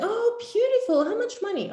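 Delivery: high, mock-sweet coach voice; sugary coach. Coach cooing at her client, high and sweet. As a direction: coo it like an overeager coach.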